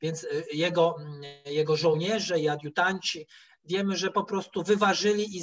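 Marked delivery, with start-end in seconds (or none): none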